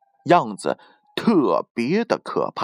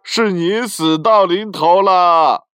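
loud, slow triumphant shout; 7 semitones higher, 5 dB louder, slower than usual. Acted. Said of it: "小伙子大叫道".

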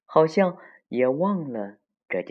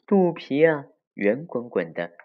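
usual speaking voice

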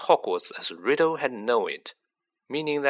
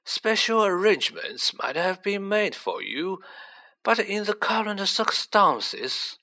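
put-on high-pitched elderly voice, gently regretful; regretful old headmaster; 9 semitones higher than usual. A narrator's reading. Headmaster explaining a refusal to a pupil, regretful.

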